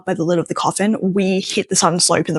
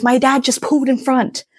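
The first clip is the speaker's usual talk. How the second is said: raised, panicky retold voice; imitating a friend in full panic; high frantic complaint, lightly played; freaking out; 6 semitones higher than usual. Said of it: "he is full on freaking out".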